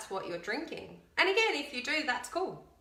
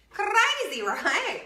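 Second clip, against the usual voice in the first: loud and much higher, amazed; excited disbelief; 11 semitones higher, 8 dB louder, much slower than usual. Real, not acted. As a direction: burst out in amazement. Reacting to the sugar count.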